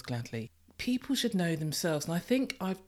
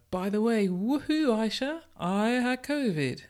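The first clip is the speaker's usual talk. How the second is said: mock cheerful announcement; 7 semitones higher than usual. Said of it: "walk in and say to her".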